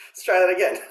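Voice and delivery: sheepish laugh through the words. Real, not acted. Teacher laughing at his own flub before restarting, laughing. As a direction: laugh it off and reset. Laughs after fumbling the triplet.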